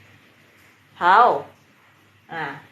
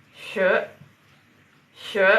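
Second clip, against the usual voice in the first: usual speaking voice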